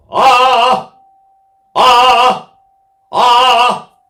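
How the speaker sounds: loud, high accented ah from the diaphragm; diaphragm accents. Shown as a demonstration. Singer demonstrating diaphragm accents to the class, loud punched ahs. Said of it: "you literally hit the diaphragm".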